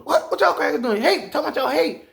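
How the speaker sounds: high, loud comic hollering; mock outraged challenge; hammed-up storm confronting people; tornado as bully. Voicing tornado confronting trailer park.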